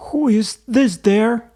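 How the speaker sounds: high, loud startled cry of "Who is this there?"; frightened gasp. A narrator's reading. Polina startled at midnight, frightened cry. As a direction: jump in fright at a shape in the dark. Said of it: "she gasped, frightened".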